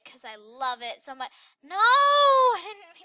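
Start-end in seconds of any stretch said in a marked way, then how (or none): 1.6-3.0 high, loud, slow wail with a laugh; dismayed cry. Real, not acted. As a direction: wail at the mistake. Clicks wrong again.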